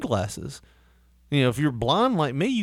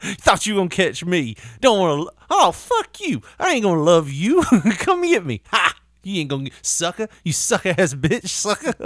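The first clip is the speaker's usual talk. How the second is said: higher mocking dog voice; taunting dog; 6 semitones higher than usual. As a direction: tease the owner like a bratty dog.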